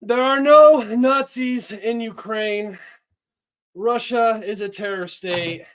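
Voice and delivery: high, slow hammed-up accented voice; a bad, overblown Zelensky; Zelensky impression. Streamer asked by chat for a Zelensky impression, hammed-up and slow. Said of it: "can you do your best Zelensky impression".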